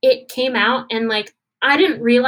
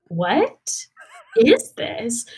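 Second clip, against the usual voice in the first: drawn-out, baffled question with a pause mid-phrase; retold bewilderment, each word left hanging; baffled reaction; much slower than usual. Singer reacting to a strange song, baffled.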